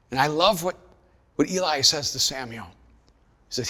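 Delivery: ordinary talk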